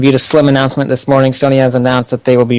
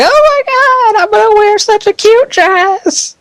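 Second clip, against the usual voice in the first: put-on very high, slow valley-girl gush; high, hammed-up girly squeal; mocking excited girl. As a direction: parody squealing schoolgirls. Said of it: "all the girls were all freaking out".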